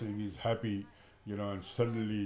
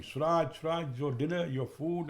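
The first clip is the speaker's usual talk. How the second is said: mild mock shout to get his attention; retold calling out; 6 semitones higher than usual. Father calling his son to dinner, mild retold shout. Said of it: "and I'm shouting".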